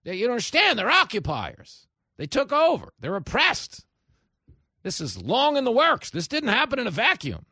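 loud, high-pitched indignant excuses; hammed-up self-righteous protest; mock victim-blaming. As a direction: imitate strident apologists shouting justifications. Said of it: "They start blaming the victims".

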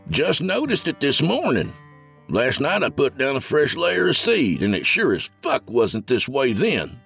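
high, fed-up country voice; exasperated hillbilly. A narrator's reading. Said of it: "in exasperation".

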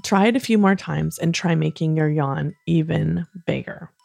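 ordinary delivery

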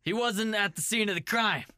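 hammed-up boss telling his cop to let it go; angry captain. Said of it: "I'm the captain".

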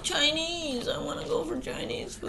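put-on high, soft little-kid voice; Raina. Mother voicing daughter wanting Chinese food, small high voice. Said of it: "And Raina's like".

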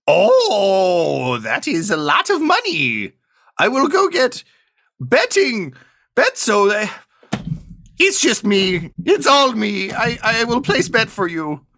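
high, slow put-on bookie voice; a squeaky-high NPC clerk, slowing down; booking agent voice. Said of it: "he looks at it and he goes".

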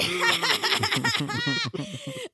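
high, hammed-up cackle; long mock-gleeful laugh; gloating cackle. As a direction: cackle in triumph after the catch. Gloating after the gotcha punchline.

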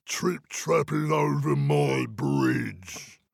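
slow, drawn-out troll challenge. Acted. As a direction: boom it out slowly like a troll. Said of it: "peered down ... and said".

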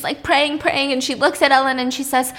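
normal talking voice

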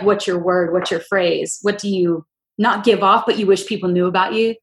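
ordinary talk

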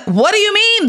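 high, startled disbelief; questioning, amazed; incredulous. Retold or imitated. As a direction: friend can't believe the offer is so casual. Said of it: "And I was like".

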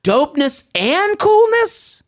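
high, slow mock-cool drawl; wannabe-cool dad. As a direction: mimic the cringey texter with exaggeration. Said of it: "to have such a switch".

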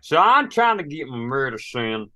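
hammed-up backwoods drawl; playful hillbilly drawl; country drawl. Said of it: "a little bit of drawl".

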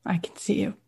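softly, as if sharing a secret; hushed reply. Retold or imitated. Retelling Oprah's answer to Sherry, hushed and tender. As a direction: drop to a near whisper on the last words.

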